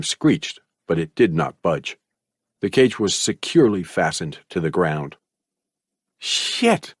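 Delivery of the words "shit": unvoiced muttered curse. A narrator's reading. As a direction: spit the curse under your breath. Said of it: "Malcolm muttered".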